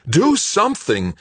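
high, loud frantic shout. A narrator's reading. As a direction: scream it at him. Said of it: "Melissa shouted".

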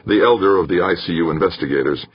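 ordinary delivery

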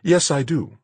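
high, defiant woman's retort. Acted. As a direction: stand your ground defiantly. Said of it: "she said defiantly".

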